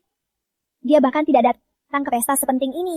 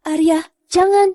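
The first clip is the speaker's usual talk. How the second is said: high, frantic calls for help; desperate, calling out for anyone; panicked cry for help; 5 semitones higher than usual. Acted. Sister falls, calls for help.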